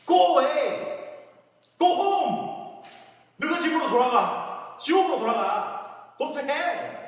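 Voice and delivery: stretched rebuke shouted at Satan; stretched commanding shout. Pretend. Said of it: "영어로 얘기했어요".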